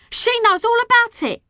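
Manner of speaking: high, loud boast; triumphant yell; proud shouting. A narrator's reading. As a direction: crow it to the crowd.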